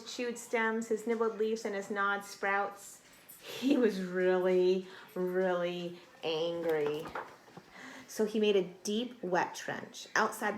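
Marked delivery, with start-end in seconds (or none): 3.4-7.0 drawn-out, heavy really really angry; slowly, with a playful frown; slow mock anger. A narrator's reading. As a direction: drag it out for drama.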